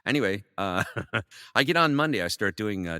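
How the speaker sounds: normal talking voice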